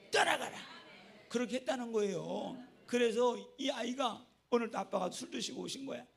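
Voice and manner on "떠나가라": higher, louder rebuke on 떠나가라; loud 떠나가라 with a falling end; commanding shout. Retold or imitated. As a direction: bark 떠나가라 like a deliverance command. Repeated command in prayer.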